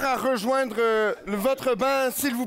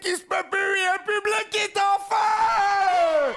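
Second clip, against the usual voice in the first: higher, shouted crowd hype; 8 semitones higher than usual. Real, not acted. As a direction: fire up the audience. Referee hyping the crowd.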